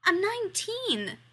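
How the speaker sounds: high, slow whiny protest. A narrator's reading. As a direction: whine it in protest. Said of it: "he protests".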